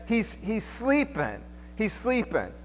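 soft and slow, lightly voiced Jesus; gentle hush. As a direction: ease it out quietly, unhurried. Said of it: "He says".

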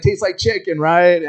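dad rubbing it in; taunting dad. Acted. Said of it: "He's like".

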